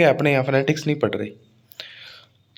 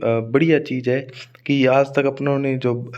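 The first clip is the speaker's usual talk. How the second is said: usual speaking voice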